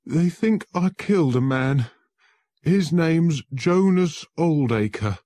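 high, loud, shaken; frightened confession. Acted. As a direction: blurt out the accusation in fear. Accused man explains his plight.

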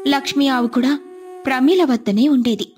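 plain reading voice

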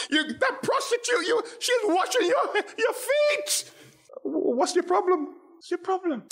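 high, indignant accusing voice with a laugh; hammed-up outraged busybody; scolding critic. Critics scolding Jesus for eating with sinners, high and indignant.

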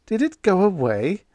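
high, loud disbelieving ask; alarmed question. A narrator's reading. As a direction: ask it startled. Radioman asks in disbelief.

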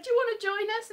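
high invite. Retold or imitated. Flatmates invite, acted quote.

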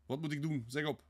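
high, urgent plea; desperate demand. A narrator's reading. Despairing man asks Sonja for direction, desperate demand. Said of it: "mismaakt van wanhoop".

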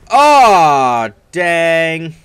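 loud high groan of disappointment; loud dismay. Real, not acted. Gamer groaning loudly after dying in the game. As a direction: wail it, annoyed at losing.

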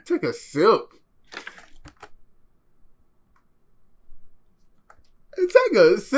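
loud, high-pitched mocking echo. Viewer echoing the clip's water offer, mocking. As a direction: throw it back high and very loud.

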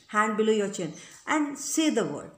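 ordinary delivery

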